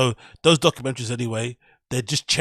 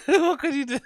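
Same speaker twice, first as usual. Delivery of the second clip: high silly voice; 4 dB louder than usual. Shown as a demonstration. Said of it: "repeats line in put-on high voice".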